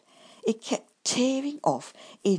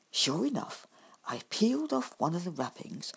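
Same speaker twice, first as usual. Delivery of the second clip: usual speaking voice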